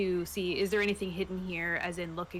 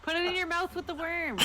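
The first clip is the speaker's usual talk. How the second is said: laughing; 10 semitones higher than usual. Real, not acted. Player laughing while joking.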